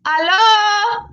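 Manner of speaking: high, drawn-out hello rising at the end; housekeeping lady. Retold or imitated. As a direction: mimic a maid calling into the room. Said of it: "it sounded like the lady".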